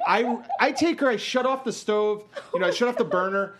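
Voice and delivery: normal talking voice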